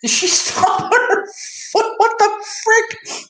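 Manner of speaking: high-pitched stunned reaction; shocked disbelief. Real, not acted. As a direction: squeak out your disbelief at the clip. Reacting to wild clip.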